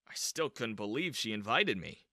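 ordinary delivery, nothing marked

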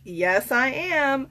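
ordinary delivery

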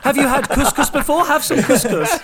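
patronising dinner-party host, lightly hammed up; condescending posh host. Posh host offering peasant food, mock patronising. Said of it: "as a liberal middle class person going".

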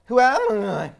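game show announcer. Acted. Quiz segment announcement.

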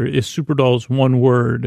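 ordinary delivery

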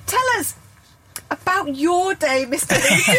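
can barely get the question out for laughing; laughing through it. Real, not acted. Host asking Carl about his day, still laughing.

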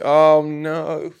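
a sudden, loud, pained cry of dismay. Real, not acted. Reading a sad Reddit post about a dog, a loud dismayed cry. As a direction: blurt it out, hurt for the dog.

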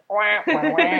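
muffled trombone wah-wah babble; wah-wah like a muted horn; Charlie Brown teacher voice. Retold or imitated. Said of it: "the teachers in Charlie Brown".

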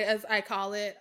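ordinary talk, nothing marked

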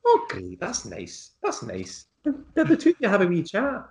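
bubbly Scottish presenter, pitched high; Lorraine Kelly impression. Host gushes at the painter in a Scottish accent. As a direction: gush like a breakfast TV host. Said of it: "what I can do is a fairly solid Lorraine Kelly".

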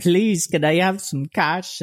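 slow, high mock plea; tearful begging woman. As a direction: imitate a beggar pleading for help, drawn out. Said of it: "kept crying about her child".

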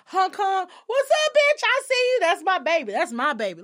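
high, loud hype man calling out; hyped-up cheering. Retold or imitated. Supportive partner hyping her up, high and loud. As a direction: cheer her on from across the street. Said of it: "would have been like".

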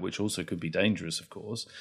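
ordinary talk

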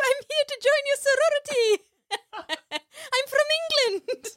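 high, loud hammed-up British accent; mock British falsetto, proudly announced; Missus Doubtfire. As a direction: squeal it in an exaggerated English-Scottish granny accent. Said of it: "don't really know what her accent is like".

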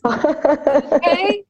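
high, rising bewildered "Okay"; clueless husband. Retold or imitated. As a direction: play him lost for what to do. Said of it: "his face just popping up".

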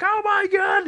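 very high-pitched panicked squeal; mock panic. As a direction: imitate a friend panicking on a rollercoaster. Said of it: "And she just panicked".

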